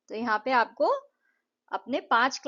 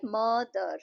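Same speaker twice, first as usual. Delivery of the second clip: मदर slowly broken into म and दर; syllable by syllable; much slower than usual. Shown as a demonstration. Teacher repeating a two-syllable example, slowly split. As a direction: stretch each of the two beats in मदर. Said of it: "टू syllables के words कैसे हैं".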